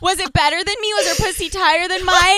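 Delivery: high, loud, rapid-fire questions; frantic prying. Acted. Girl grilling her boyfriend about his ex, frantic. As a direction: pile on questions, almost laughing. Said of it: "I'm like".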